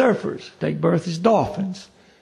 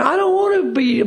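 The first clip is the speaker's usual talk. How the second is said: whiny protest; 8 semitones higher than usual. Retold or imitated. Someone objects to being reborn an animal, whiny protest. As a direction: protest in a raised, mock-plaintive voice.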